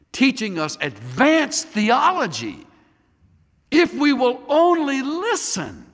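high and loud, building; rising preaching. Acted. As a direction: lift the voice to a peak.

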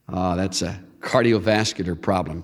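ordinary talk, nothing marked

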